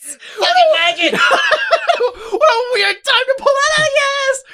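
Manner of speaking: high, loud words through laughter; cracking up, squeaking with laughter; laughing hard. Real, not acted.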